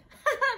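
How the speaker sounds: high, loud burst of laughter; bright laugh. Real, not acted. Laughs at own joke.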